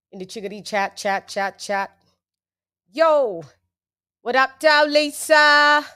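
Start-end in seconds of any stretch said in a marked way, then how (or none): 2.9-5.8 loud, high hype shout-out; pumped-up welcome; hype greeting. Acted. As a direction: greet her like a radio host.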